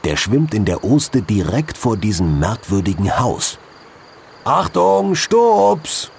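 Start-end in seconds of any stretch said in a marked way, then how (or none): 4.4-5.1 silly clown. Acted. Warning a fish by calling. 5.1-6.1 silly clown. Acted. Warning a fish by calling.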